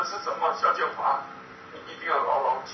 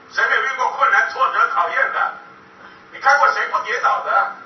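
loud, rising appeal to the crowd, higher and louder at the end; rousing preaching; 4 semitones higher, 6 dB louder than usual. Acted. Speaker rallying the audience as ordinary friends, preaching loudly. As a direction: build them up like a preacher at full force.

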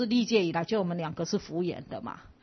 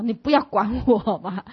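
slow, louder, exasperated plea; helpless resignation; 3 semitones higher, 8 dB louder than usual. Retold or imitated. Final helpless reply telling classmate to leave her alone. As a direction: give up, drawn out. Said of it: "最后的一个无奈".

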